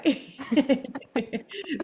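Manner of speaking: high, bright burst of laughter; high giggle; happy laugh. Real, not acted. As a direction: laugh it off, moved and giddy. Laughs about nearly crying.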